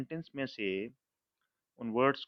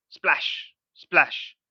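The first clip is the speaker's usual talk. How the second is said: breathy, louder splash sound; teacher acting out water's splash; imitating a splash; 4 semitones higher, 6 dB louder than usual. Shown as a demonstration. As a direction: make the water splash with your voice. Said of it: "पानी कैसे बोलता है?".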